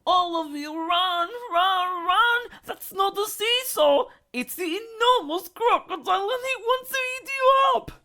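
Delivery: very high, fast chattering warning; frantic monkey. A narrator's reading. Monkey warning the children from the swings, frantic. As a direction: gabble the alarm. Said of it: "Muggle Worm shouted".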